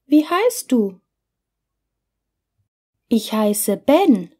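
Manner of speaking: ordinary talk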